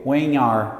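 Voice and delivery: "When are" run together as "whe-nare"; "When are" as a single word; linked words. Shown as a demonstration. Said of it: "a gente emenda isso aqui".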